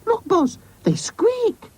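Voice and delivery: put-on very high, slow boy's squeal; excited cry. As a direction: squeal the discovery in a child's voice. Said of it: "cried Oz".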